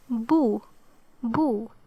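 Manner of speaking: Boo as one clean syllable; Boo shown clearly. Shown as a demonstration. Teacher showing Ba with Dhamma, Boo sounded clearly. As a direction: sound out Boo for learners, then repeat. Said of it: "it would be pronounced like".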